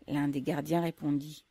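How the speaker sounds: usual speaking voice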